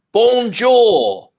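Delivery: loud, high mock English "bonjour"; English person abroad. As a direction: call it out like an English tourist, hammed-up. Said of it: "English people".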